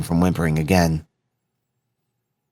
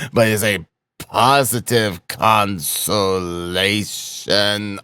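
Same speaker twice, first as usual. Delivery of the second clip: very slow, drawn-out declaiming; labored, long-drawn cry of a man being dragged; calling out while being shaken; 3 semitones higher, much slower than usual. A narrator's reading. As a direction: stretch every word out, swelling up on the last. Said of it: "he called out".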